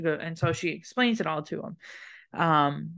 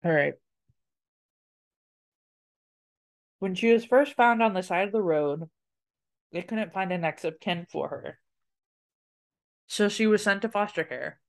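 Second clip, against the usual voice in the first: talking around ice cube; ice in mouth. Real, not acted. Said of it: "I had a piece of ice in my mouth".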